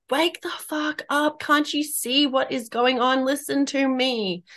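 high, slow, drawn-out urgent plea; mock intuition screaming. Intuition demanding to be heard by a woman ignoring it, mock screaming. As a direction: act out a scream without the volume. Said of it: "our intuition is screaming at us".

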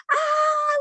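sudden high startled yelp at a bug in her hair; a squeal of panic, way up high; freaked-out shriek. Retold or imitated. Preschool worker feels a bug in her hair: high panicked yelp. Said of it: "was freaking out".

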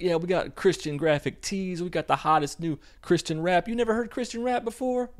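high, bubbly salesgirl voice, a hint of parody; mock girly clerk. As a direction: sell it like an overeager shop girl. Mocking the bookstore girl.

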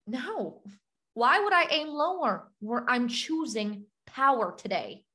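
ordinary delivery

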